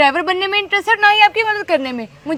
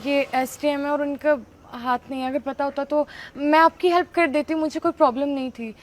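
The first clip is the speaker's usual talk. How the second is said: softer, lower apologetic voice; sheepish backing down; 4 semitones lower, 8 dB quieter than usual. Acted. Girl apologizes to SDM officer mother, quiet and sheepish. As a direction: deflate and mumble your excuse. Girl backs down after learning truth.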